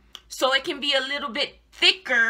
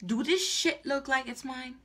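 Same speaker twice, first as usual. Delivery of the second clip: usual speaking voice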